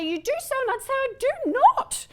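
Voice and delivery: high-pitched, stretched-out mock denial; mock squabble. Lecturer re-enacts arguing with her students, high mock squabble. Acted back-and-forth argument with students.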